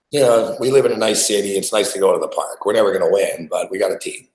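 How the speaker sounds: usual speaking voice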